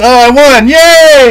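high, loud victory whoop; excited cheer. Real, not acted. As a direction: burst out cheering at a win. Celebrating a round win.

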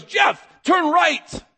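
high, loud, stretched-out order; commanding yell. Acted. God yelling a direction to the preacher, commanding yell. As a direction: shout each word slowly as a command. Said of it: "When God yells out my name".

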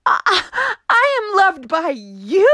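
very high, stammering wonder; amazed exclamation. Acted. Juniper amazed to learn he is loved, very high. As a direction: gasp it in delighted disbelief. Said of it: "he exclaimed".

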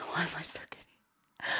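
a giggly whisper to herself. Real, not acted. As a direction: whisper it under the breath. Giddy self-talk after a laugh.

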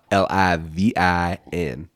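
slow drawn-out spelling, lightly imitated; Matthew McConaughey. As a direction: spell it out like a laid-back film star. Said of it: "Unless you're Matthew McConaughey".

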